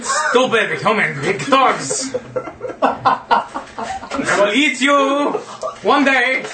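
monster voice. Acted. Monster threatens the party.

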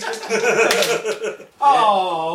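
laughing. Real, not acted. Laughing at his own plan.

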